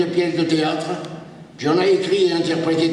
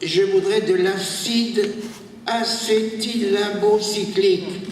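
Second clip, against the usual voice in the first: higher, slow, fumbling patient voice; forgetful patient; 7 semitones higher, slower than usual. Acted. To the doctor, a forgetful patient asks for medicine, higher and slow. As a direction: play the muddled patient groping for the word.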